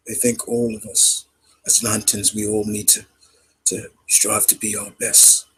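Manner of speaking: put-on British actor's accent, held back in volume; Mark Strong impression. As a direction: speak as a quiet British mentor. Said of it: "Mark Strong, when he talks".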